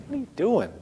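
high-pitched mimicked child's question; lightly mocking kid voice; kids reacting. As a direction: imitate kids baffled by a mad parent. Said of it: "and they're like".

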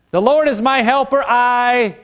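fervent preaching. Acted. Preaching peak.

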